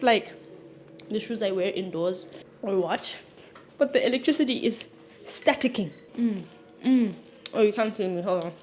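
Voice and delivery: usual speaking voice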